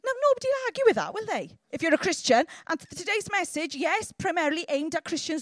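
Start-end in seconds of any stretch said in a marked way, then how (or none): 0.1-1.5 quick, high and bright, tossed off; fast rhetorical aside. Real, not acted. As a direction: rattle it off with a grin. Rapid rhetorical aside in sermon.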